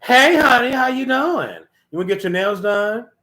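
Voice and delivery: high, loud, sugary greeting; fake-sweet friend. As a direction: gush over her like a false friend. Acting a two-faced friend's sweet greeting.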